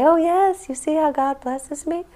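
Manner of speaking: high, sing-song voice of a self-satisfied believer; smug pious mock. Mocking a believer who boasts that God blesses them, high and sing-song. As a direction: preen like someone showing off God's favour.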